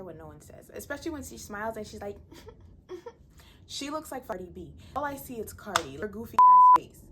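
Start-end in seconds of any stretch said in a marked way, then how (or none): 2.2-3.6 airy giggle. Retold or imitated. Imitating Zaina's smile. 6.0-7.0 much higher and louder playful shriek; giddy teasing screech; squealing shout. Real, not acted. Teasing Zaina's look.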